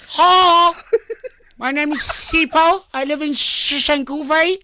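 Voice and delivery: hammed-up mock Model C introduction; fake Model C accent. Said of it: "who fake the Model C accent".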